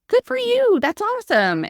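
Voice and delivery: high, bright-eyed cheer of a friend; excited supporters. Retold or imitated. Friends cheering on her trading class, excited. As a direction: gush like a happy friend. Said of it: "a couple people that are like".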